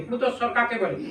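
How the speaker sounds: ordinary delivery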